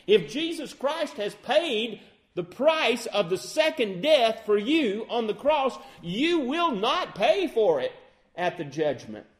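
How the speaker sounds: fiery pulpit delivery of the gospel; full-force preaching. Acted. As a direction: preach it with rising fervor.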